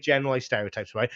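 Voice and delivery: ordinary talk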